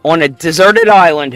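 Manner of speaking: usual speaking voice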